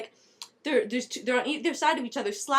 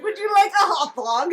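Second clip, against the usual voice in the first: hot dog seller; 6 semitones higher, 6 dB louder than usual. Acted. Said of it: "Valentine's like".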